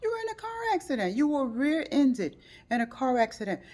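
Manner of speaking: high, fast, loud concern; worried. Retold or imitated. Speaker checking on friend after crash, worried. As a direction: rush to check on her. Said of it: "I had to see physically".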